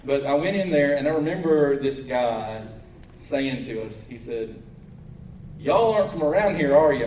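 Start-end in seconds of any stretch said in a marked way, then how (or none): 5.6-7.1 put-on raised, teasing Southern-accent jab; demeaning mock Southern twang. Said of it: "mocking our accent".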